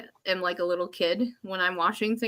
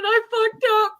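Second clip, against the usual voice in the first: tearful confession; 13 semitones higher, 4 dB louder than usual. Retold or imitated. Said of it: "I said".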